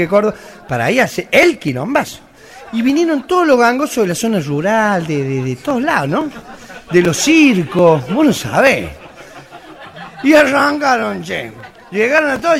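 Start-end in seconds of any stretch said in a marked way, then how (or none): none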